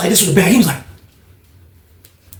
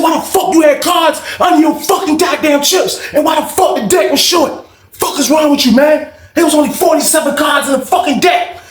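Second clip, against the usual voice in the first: angry shout; 11 semitones higher than usual. Retold or imitated. Angry player yells at the cheater about the deck, shouting high. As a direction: tear into the cheat.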